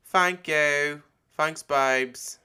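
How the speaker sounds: loud, high put-on British thanks; loud playful British accent; mock British thanks. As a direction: gush it in a hammy British accent.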